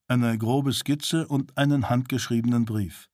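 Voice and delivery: usual speaking voice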